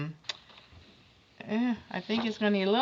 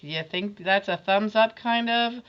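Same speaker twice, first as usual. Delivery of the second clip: ordinary delivery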